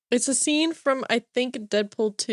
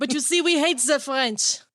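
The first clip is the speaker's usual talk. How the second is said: mock-disdainful French accent; hammy French accent, lightly haughty; 3 semitones higher than usual. Host teasing about the French, in a mock French accent. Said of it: "I started with this French accent".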